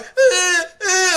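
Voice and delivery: high, loud mock sobs; mock sobbing. Convict crying in court, hammed-up sobbing. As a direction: sob it out in a hammed-up way.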